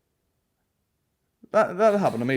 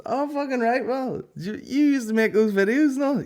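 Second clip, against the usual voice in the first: high, loud, excited Belfast bloke voice; mocking the bar guy; 14 semitones higher, 4 dB louder than usual. Comedian mimics a rude bar guy recognising him, high and loud. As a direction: ham up a nosy punter spotting a comic. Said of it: "this guy's like".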